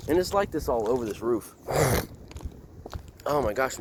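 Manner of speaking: blocked nose from the cold; stuffy nose. Real, not acted. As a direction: talk through a stuffy nose. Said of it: "I'm so stuffy".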